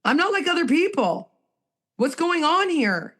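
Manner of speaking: bewildered quoted self; pained admission. Retold or imitated. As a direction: pitch it up as a bewildered plea. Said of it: "[name] admits".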